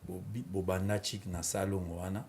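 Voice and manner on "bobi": usual speaking voice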